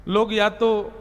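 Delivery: normal talking voice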